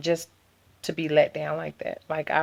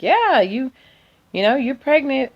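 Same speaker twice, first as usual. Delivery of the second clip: voicing the doctor; 9 semitones higher, 5 dB louder than usual.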